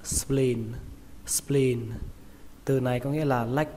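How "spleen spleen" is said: "spleen" drawn out and closed on N; long vowel, final N. Shown as a demonstration. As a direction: stretch the "ee" in "spleen", land on N. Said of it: "Kéo nó ra và kết thúc bằng âm N". (kéo dài "spleen" rồi kết thúc bằng âm N)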